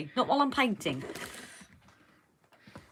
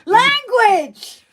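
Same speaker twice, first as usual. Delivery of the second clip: mock scold; 12 semitones higher, 11 dB louder than usual. Scolding swearing.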